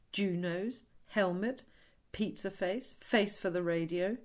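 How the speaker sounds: a hint of a bully listing insults; mock taunting names. Shown as a demonstration. Therapist voicing the bullies' names for the client, mild mock taunt. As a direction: rattle off the insults lightly. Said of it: "by saying some horrible names".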